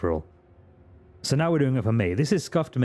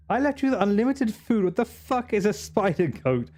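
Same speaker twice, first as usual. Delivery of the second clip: high, indignant divine voice; Jesus voice; 9 semitones higher than usual. Pretend. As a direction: scold like a disappointed god. Voicing Jesus on his return.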